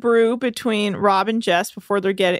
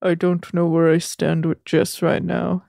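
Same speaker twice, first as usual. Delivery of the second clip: slow, lower put-on voice; impersonating Rob; 5 semitones lower than usual. Recapper mimics a castmate unsure where he stands, in slow low impersonation. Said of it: "Well, here, he goes".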